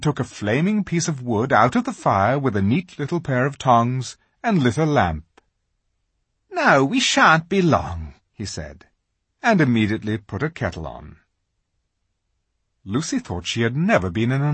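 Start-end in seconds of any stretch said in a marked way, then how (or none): 6.5-8.1 fussy fawn. A narrator's reading. Tumnus at his cave.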